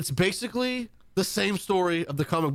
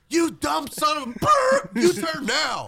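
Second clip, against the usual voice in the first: high angry yell at driver; yelling GPS; 12 semitones higher, 4 dB louder, slower than usual. Retold or imitated. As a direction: scream at the driver. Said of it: "it yells at you".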